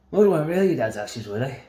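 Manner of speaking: cheeky put-on voice, starting loud; imitated drunk lad. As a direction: play the lad, pitch jumping up. Acting out the Scottish lad's reply.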